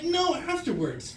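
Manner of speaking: high, slow mock-impatient answer; imitating an exasperated doctor; exasperated correction. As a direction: spell it out as if to an idiot.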